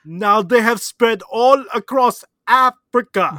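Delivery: Mike Tyson impression. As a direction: boom it out in a bad put-on African accent. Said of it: "That was my impression of Mike Tyson".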